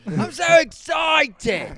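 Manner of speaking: high, loud excited shout. Real, not acted. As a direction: yell it with delight. Excited outburst.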